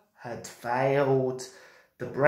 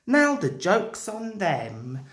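usual speaking voice

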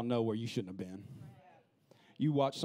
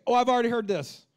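dismissive scoff; 10 semitones higher, 10 dB louder than usual. Retold or imitated. Mocking bored listener.

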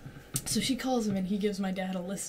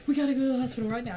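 fast urgent words; urgent rush; 4 semitones higher, 4 dB louder, much faster than usual. Retold or imitated. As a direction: rush it like an emergency. Mom urgently retold.

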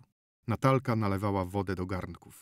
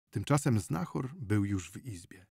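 plain reading voice

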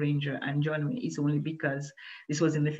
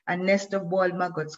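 ordinary delivery, nothing marked

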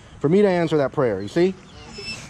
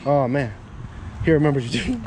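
normal talking voice

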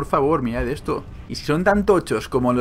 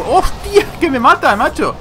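real high, loud yelp of alarm; startled shout; 12 semitones higher, 7 dB louder, slower than usual. Real, not acted. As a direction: blurt it out as the game ambushes you. Startled by attack in game.

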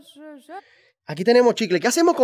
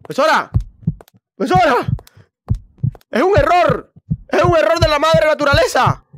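high, loud indignant yells; shouting protest; 9 semitones higher, 4 dB louder than usual. Real, not acted. Player yelling at the teacher about a glitch, loud protest. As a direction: shout that it's all a mistake.

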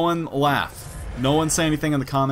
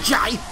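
high-pitched "yai" yelp; battle cry; 10 semitones higher, 4 dB louder than usual. Real, not acted. Player swinging at an enemy, battle cry. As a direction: yelp sharply with the strike.

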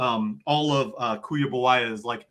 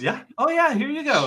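eager shopkeeper; 12 semitones higher than usual. Acted. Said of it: "He goes".

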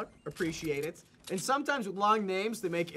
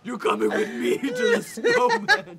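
high cackling villain, slow; dark lord; 7 dB louder than usual. Pretend. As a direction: gloat as the mad villain. Playing the villain snowman.

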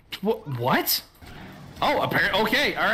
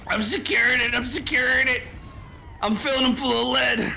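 marine battle shout; 7 semitones higher than usual. Acted. Quoting Aliens marine line.

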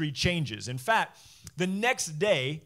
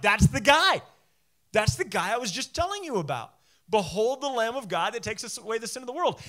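loud and high, then settling; John spotting Jesus, loud and high at first, then calming; excited pointing out; 5 semitones higher, 5 dB louder than usual. Retold or imitated. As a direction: call it out to the crowd, thrilled.

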